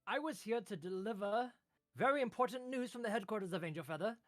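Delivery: high, loud put-upon clerk; high complaining clerk voice; whiny clerk. Acted. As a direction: whine like a stuck little paper-pusher. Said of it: "and he's like".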